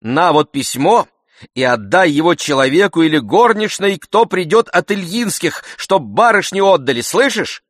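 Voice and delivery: high, loud, insistent instructions; agitated order. A narrator's reading. Agitated master gives maid orders.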